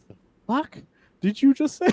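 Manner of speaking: high-pitched mock disbelief; squeaky-high retold shock; Wonder Woman. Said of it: "look how Wonder Woman's face".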